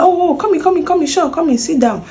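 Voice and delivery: mock the partner's eager come in; eager boss voice.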